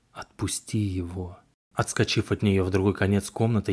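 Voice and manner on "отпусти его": eerie slow whisper, much softer; singsong whisper. A narrator's reading. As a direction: breathe it out like a ghost. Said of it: "знакомым жутким шёпотом".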